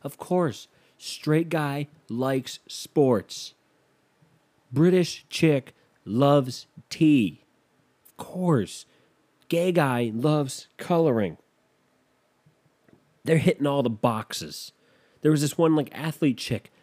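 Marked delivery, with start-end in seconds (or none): 0.0-11.4 slow, deliberate mock sarcasm; dripping sarcasm, very slow; sarcastic slow drawl. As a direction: drag out each cliché with scorn. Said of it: "I was like".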